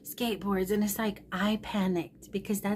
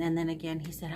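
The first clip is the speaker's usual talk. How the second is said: ordinary talk, nothing marked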